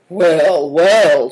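loud, drawn-out marvelling; impressed, emphatic exclamation. A narrator's reading. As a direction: let the admiration swell loudly. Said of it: "said Greencoat, visibly impressed".

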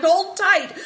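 high, loud urgent shout. Acted. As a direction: yell it out, high and forceful. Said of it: "and Big Tiger shouted".

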